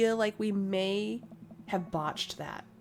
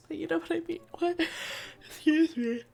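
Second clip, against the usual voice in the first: ordinary delivery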